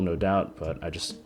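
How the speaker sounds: ordinary talk, nothing marked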